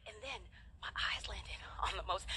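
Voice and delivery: hushed, building suspense; suspenseful whisper. Real, not acted. Preacher teasing the big reveal, awed whisper. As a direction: whisper it with awe.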